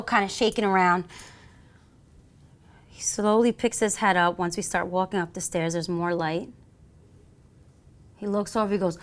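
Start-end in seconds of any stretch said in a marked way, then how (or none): none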